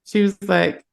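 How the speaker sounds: usual speaking voice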